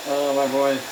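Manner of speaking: usual speaking voice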